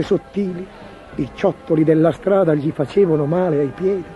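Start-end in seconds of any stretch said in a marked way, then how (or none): none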